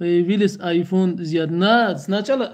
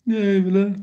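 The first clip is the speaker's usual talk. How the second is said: ordinary talk, nothing marked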